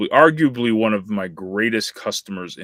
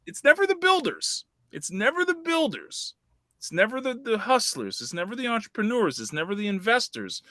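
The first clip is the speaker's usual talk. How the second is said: insistent refrain, pitched way up; emphatic high-pitched insistence; 10 semitones higher than usual. Real, not acted. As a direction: hammer the point in a high, strained-up register. Emphatic repeated point about complainers.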